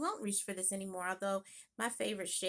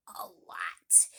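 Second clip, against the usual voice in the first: whisper. Real, not acted. Whispered tag.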